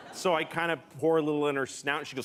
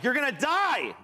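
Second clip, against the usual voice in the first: panicked yell; 8 semitones higher than usual. Retold or imitated. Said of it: "And I was like".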